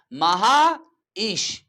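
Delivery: "महाईश" stretched high for the class; slow-motion महाईश, आ then ई; drawn-out pronunciation. Shown as a demonstration. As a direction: say महाईश very slowly, both long vowels held. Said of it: "और मैंने क्या कहा?".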